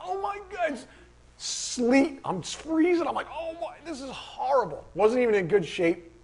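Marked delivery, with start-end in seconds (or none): 0.0-4.8 high-pitched runner's lament; mock misery. Runner freezing in sleet during a race, mock misery. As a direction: complain high, as if suffering through it.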